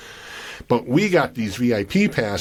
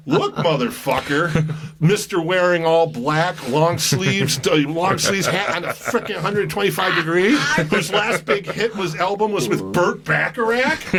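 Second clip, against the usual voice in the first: mock him through laughter; mock insult; 6 semitones higher than usual. Storyteller retelling how he insulted Costello, laughing. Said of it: "I literally said that".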